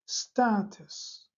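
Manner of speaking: استاتس said slowly, each sound clear; teacher repeating استاتس slowly; slow model word. Shown as a demonstration. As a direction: draw out استاتس carefully.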